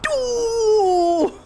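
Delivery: usual speaking voice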